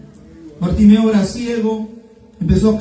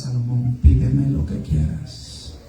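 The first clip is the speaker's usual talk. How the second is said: lightly voiced God, low, soft and slow; slow, hushed offer from the Lord; God speaking; 5 semitones lower, 5 dB quieter, slower than usual. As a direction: make a low, unhurried divine offer. Preacher voices God speaking to Solomon.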